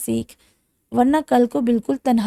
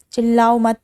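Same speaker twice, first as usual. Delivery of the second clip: louder, sharp order to stop shouting; loud command; 5 dB louder than usual. A narrator's reading. Man tells screaming captive to stop, loud command. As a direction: cut her off with a loud stop. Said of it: "وہ بلند آواز میں بولا".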